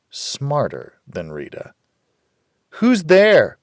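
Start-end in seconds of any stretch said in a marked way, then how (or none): 2.8-3.6 high, loud call through a door. A narrator's reading. Rita calling through the door, loud and high. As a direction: call out to the stranger outside. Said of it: "Rita called through the door".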